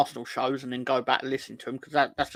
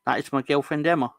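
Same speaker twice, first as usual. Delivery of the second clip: quoting a chatter; 5 dB louder than usual. Retold or imitated. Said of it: "he's then told, with".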